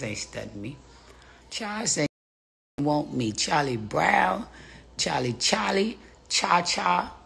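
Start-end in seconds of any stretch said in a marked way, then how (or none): none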